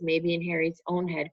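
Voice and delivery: ordinary delivery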